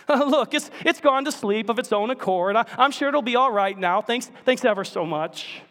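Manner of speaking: high, fast, flustered backpedal; nervous ghost. Acted. Ghost backing out of the angel's offer, high flustered voice. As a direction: talk your way out of it quickly.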